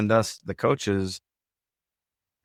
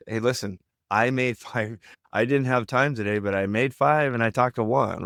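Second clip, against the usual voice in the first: upbeat inflection; 3 semitones higher than usual. Shown as a demonstration. Associate reporting to coach with changed mindset, upbeat inflection. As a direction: voice lifted a little, positive and assertive. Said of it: "my voice went up a little bit".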